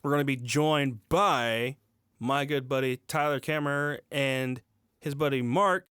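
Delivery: ordinary talk, nothing marked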